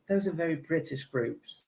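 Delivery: slow, soft put-on British accent; a lightly done posh British voice; British accent, quietly; mock British accent. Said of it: "[name] is British".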